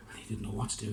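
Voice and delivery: ordinary delivery, nothing marked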